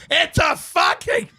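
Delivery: slow, high-pitched strained mock yell; mock scream. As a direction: imitate him screaming at the secretary without full volume. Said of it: "scream at the secretary".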